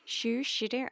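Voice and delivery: Sioux City said as "shur shitta", mushy lisping; odd Sioux City. Shown as a demonstration. Host jokingly mispronouncing her hometown, Sioux City slurred. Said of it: "I don't know why I did, did that".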